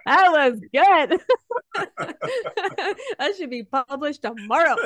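hammed-up pride, loud and high, between laughs; playful self-praise. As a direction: crow like a writer thrilled with their work. Acts out proud writer, amid laughter.

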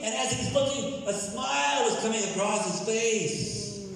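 high-pitched, impassioned storytelling sermon; excited preacher acting out the scene; preaching at full force. Real, not acted. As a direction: preach it high and urgent, building suspense. Preacher dramatizing a Bible scene.

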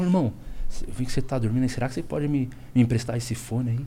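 softly voiced polite request; hushed asking. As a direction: keep it low, don't wake him. Asking a sleeping stranger on bus.

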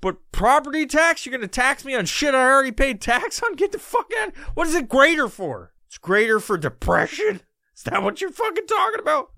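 high and loud, mock-outraged questions; indignant rant. Real, not acted. Ranting to the listener about Northwest taxes, high and loud. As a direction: bristle at every tax, pitch climbing.

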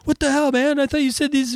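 high falsetto indignation; mock outraged player. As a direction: whine like a cheated player at the GM. Player voicing betrayed party.

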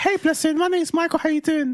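high-pitched mock introduction; put-on high voice. Man pretending to meet his partner, high fake voice. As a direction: introduce yourself in a silly high register. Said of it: "what if I sounded like this".

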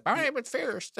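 put-on high, laughing baby voice; mock newborn voice. Dad jokingly voices newborn son naming himself, high baby voice. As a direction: pipe up high like a comic newborn. Voicing a newborn announcing his name.

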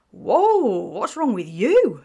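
taken aback by the scowl at the door; surprised. A narrator's reading. Alyssa startled at the door, loud surprised outburst. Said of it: "a surprised-looking Alyssa asked".

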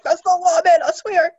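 squeaky panicked I swear; high, loud, laughing mock panic; a laughing, flustered denial; high flustered protest, laughing. Flustered denial after autocorrect error.

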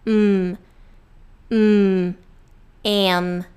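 "M" finishing on a hummed, closed-lip m. Shown as a demonstration. Teacher showing how M ends, lips closed. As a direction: hold "M" with lips shut. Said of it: "a gente fecha os lábios".

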